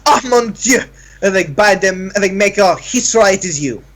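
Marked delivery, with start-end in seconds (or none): 0.0-0.8 high, dramatic French exclamation; French accent. Acted. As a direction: gasp it out like a flamboyant Frenchman. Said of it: "and he goes".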